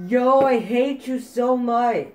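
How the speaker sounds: loud, high, drawn-out outburst; angry yell. Real, not acted. Gamer raging at an opponent, loud angry yell. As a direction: shout it slowly in real frustration.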